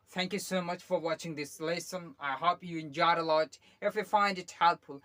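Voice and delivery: slow, loud, deliberate video sign-off; slow outro sign-off. Acted. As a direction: read the closing thanks slowly and loudly. Outro read. (धीमी, ज़ोरदार video विदाई)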